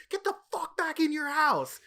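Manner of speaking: loud, high yell with drawn-out emphasis; imitated screaming. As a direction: act out a friend screaming an order. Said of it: "Wasim just starts screaming".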